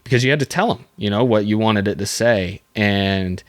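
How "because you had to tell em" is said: usual speaking voice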